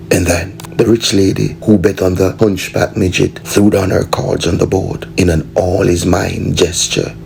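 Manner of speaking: ordinary delivery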